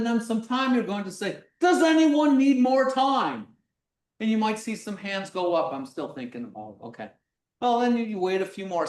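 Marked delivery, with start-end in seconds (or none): 1.6-3.5 loud, drawn-out call to the room. Shown as a demonstration. Teacher checking with a class before the vote, calling out. 5.7-7.1 low, soft mumble; muttered student reply. Acted. Student still thinking about the question, quiet mumble.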